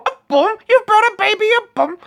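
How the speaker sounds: very high falsetto squawk; Python mother. Retold or imitated. Acting out the Brian manger scene, high falsetto. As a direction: play the suspicious mum at the door.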